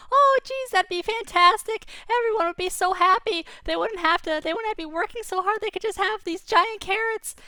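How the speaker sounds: very high, loud, squeaky glee; a rabbit who can eat for years; overjoyed rabbit. Acted. Rabbit rejoicing over ten years of food, squeaky and overjoyed. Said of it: "And he said".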